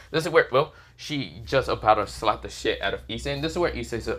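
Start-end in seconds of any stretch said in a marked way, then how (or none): none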